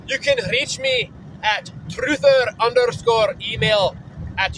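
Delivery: lightly put-on Irish accent, drawn out in places; a playful hint of an Irish brogue; mock Irish accent. Vlogger giving his email to a viewer, in a light mock Irish accent. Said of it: "for those who aren't fluent in Irish accents".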